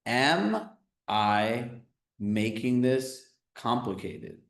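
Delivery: slow, deliberate question; said slowly. Shown as a demonstration. Coach to class, dictating the question slowly. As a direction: spell it out slowly so they can write. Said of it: "I'm gonna say it slowly".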